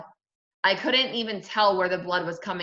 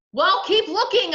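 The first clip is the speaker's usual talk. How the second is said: high, loud impatient order; yell; 12 semitones higher, 7 dB louder than usual. A narrator's reading. Brother ordering Sheila to search on, impatient yell. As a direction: yell it at her, exasperated. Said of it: "I yelled".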